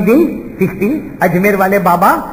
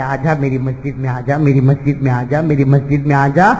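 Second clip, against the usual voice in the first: tender calling; 7 semitones lower than usual. Acted. Voicing God calling tenderly.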